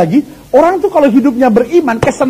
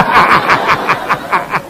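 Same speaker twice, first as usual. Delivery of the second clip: laughing; 3 semitones lower, 3 dB quieter than usual. Real, not acted. Laughing at own joke.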